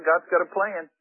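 low, soft, hesitant reply; acted sheepish uncertainty, voice dropped; unsure. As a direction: stumble quietly into a weak answer. Moses has no answer.

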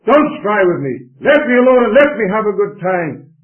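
loud, high defiant outburst; defiant shout. Acted. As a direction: shout it back at God. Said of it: "They tell God to leave them alone".

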